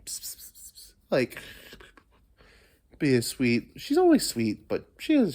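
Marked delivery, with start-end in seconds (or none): 0.1-0.9 unvoiced pssts to call a cat; breathy psst psst; whispered psst. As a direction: hiss softly to get the cat's attention. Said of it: "all up in my face".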